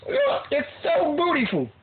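put-on high preening girl voice; high self-admiring girl; girly voice. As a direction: coo it up high. Continuing girl voice joke.

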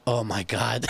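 ordinary talk, nothing marked

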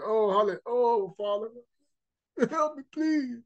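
high pleading prayer, hammed up; mock churchgoer pleading. Churchgoer crying to the Father, mock pleading. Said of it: "going to church, going".

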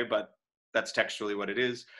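ordinary talk

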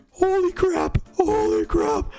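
very high, whimpering squeal; panicked wail. Real, not acted. Player asking if they will survive, panicked wail.

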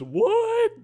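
high squeaky mocking what; imitating high what. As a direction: squeak it like him. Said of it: "The same way".

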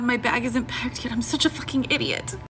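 ordinary delivery

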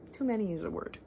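ordinary talk, nothing marked

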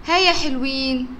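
usual speaking voice

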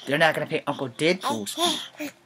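muffled behind a mask; talking through a cloth mask. Real, not acted. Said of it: "the mask is muffling my voice".

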